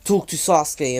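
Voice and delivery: hammed-up angry girl snapping at a rival; imitating a furious outburst. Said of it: "she goes ballistic over her because she's pissed".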